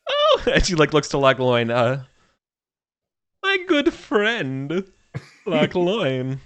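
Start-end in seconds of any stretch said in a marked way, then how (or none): 0.1-0.4 high, breathless fluster; flustered diva. Acted. Cosmonauta flustered. 3.4-6.4 slow, savoring introduction; slow diva drawl. Acted. Cosmonauta introducing.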